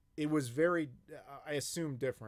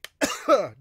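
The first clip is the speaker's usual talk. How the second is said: harsh, loud choking cough; loud coughing fit; 6 semitones higher, 14 dB louder than usual. Real, not acted. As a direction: cough hard as coffee goes down wrong. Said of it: "I'm gonna cough".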